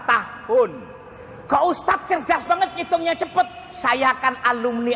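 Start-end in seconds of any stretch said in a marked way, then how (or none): 1.5-3.5 higher-pitched mock audience question; imitated admiring listener. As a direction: lightly put on a higher voice for the amazed listener.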